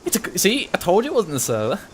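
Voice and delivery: high told-you-so; triumphant. Real, not acted. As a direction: crow it out high.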